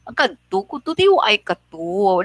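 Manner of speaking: sweet Ilonggo sing-song, the first part pitched high; Ilonggo, sweet and endearing; sweet Ilonggo lilt. Shown as a demonstration. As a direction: coo "Ang kadto ko to" high in an Ilonggo lilt. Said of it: "it's always sweet to hear, like".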